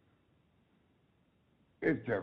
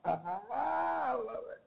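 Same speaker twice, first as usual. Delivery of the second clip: laughing; 14 semitones higher, much slower than usual. Real, not acted. Delighted by friend's accent.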